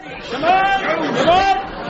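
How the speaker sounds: high, wailing panic; panicking. Acted. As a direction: wail it like the alarm bell. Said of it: "Jones, will you be quiet?".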